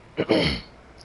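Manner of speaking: throat clearing. Real, not acted. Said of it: "excuse me".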